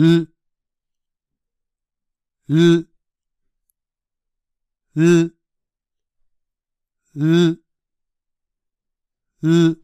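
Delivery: isolated L consonants, held firm; English L sound demo. Shown as a demonstration. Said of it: "もう一度やってみましょう". (舌を上顎につけたままの「ル」)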